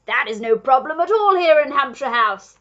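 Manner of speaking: booming reassurance from a grand host; hearty duke. A narrator's reading.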